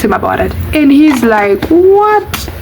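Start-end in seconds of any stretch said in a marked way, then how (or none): 1.7-2.5 high indignant what; outraged what. Retold or imitated. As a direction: scoff in shocked indignation. Boyfriend outraged at confrontation.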